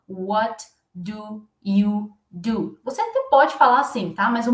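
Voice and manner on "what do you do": every word slow and separate, What do you do; slow careful version. Shown as a demonstration. Teacher showing students the slow unnatural way, slow. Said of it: "dificilmente você vai escutar essa pergunta assim".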